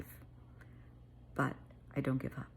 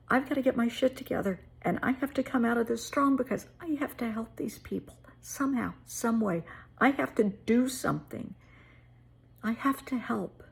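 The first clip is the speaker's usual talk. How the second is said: higher, tearful, voice close to breaking; emotional; 8 semitones higher than usual. Real, not acted. Repeating her vow to help, tearful and higher. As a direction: push through the tears, higher. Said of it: "I get a little emotional about this".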